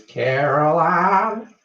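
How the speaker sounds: loud, high, very slow drawn-out call; creepy southern lady's call. Retold or imitated. Host imitating the creepy film lady for her viewers, drawn-out shout. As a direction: call the name across the house like an eerie old southern woman. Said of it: "when she shouts her, she goes".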